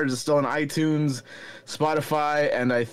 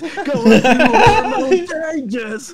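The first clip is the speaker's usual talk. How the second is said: much higher, louder, very slow cracking voice; 12 semitones higher, 8 dB louder, much slower than usual. Pretend. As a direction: ham up the breaking voice, laughing. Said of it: "You got a voice crack while you do it?".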